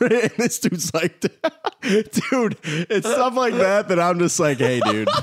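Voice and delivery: high, laughing through the words; giggling refusal. Real, not acted. Laughing quoting reluctant friend.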